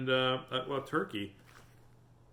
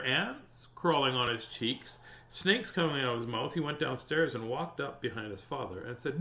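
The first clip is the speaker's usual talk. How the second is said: plain reading voice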